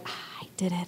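unvoiced, pleased little whisper. Mom to herself after the talk, whispered. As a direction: whisper a private victory.

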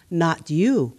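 usual speaking voice

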